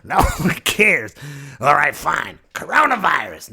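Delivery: loud, high, fed-up outburst; hammed-up angry verdict; exasperated wrath. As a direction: throw down the punishment in a huff. Mother Earth snaps and unleashes virus.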